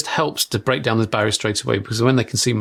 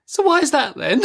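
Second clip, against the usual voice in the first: put-on higher, child-like questioning voice; curious child. Daughter asks her parent an awkward question in a small voice. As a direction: ask it like an innocent little girl. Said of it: "daughter asks after a pause".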